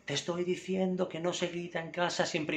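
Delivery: mock yell of a stressed parent; irritated yell. Shown as a demonstration. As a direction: pitch jumps up, snapping at the child. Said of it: "le grito".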